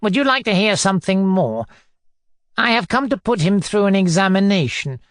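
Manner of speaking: bullying inquisitor; pompous tyrant. Acted. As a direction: lecture loftily, then demand an answer. Narrator plays Foma interrogating.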